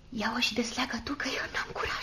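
hushed, whispered reluctance; timid whisper. Acted. Girl asking sister to open the bag, timid whisper. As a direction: whisper it softly, backing out.

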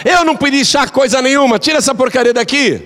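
put-on high, sharp scolding old woman; rude mother-in-law. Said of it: "Mulher grossa". (sogra grosseira, aguda e ríspida)

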